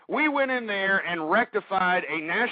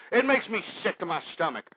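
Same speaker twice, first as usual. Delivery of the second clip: ordinary delivery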